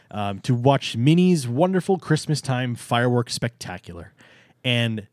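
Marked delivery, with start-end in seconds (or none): 3.3-4.1 emphasize the word "spectacular"; stress on spectacular. Real, not acted. Host naming Minnie's fireworks show, emphasis on "spectacular". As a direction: give "spectacular" its own deliberate weight. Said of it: "I b-, emphasize the word spectacular".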